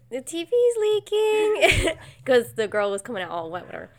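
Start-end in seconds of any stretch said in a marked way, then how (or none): 0.1-2.0 high, slow, loud wail; hammed-up movie voice. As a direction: wail it like a spoof horror film. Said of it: "and, like".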